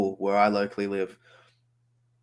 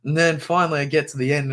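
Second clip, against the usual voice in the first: usual speaking voice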